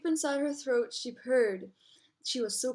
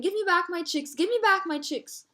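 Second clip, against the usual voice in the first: high, insistent cry, slightly louder; storybook yell; 6 semitones higher, 3 dB louder than usual. A narrator's reading. Mother ostrich still chasing the lioness, high mock yell. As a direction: throw the pitch up and demand, not full force.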